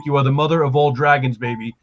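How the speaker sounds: usual speaking voice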